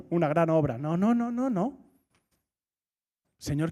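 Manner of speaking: ordinary delivery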